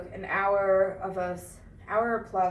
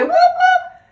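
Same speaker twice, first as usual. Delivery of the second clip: high whooping cheer; excited cheer; 16 dB louder than usual. Real, not acted. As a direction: whoop it up like a hype crowd. Hosts cheering in the intro.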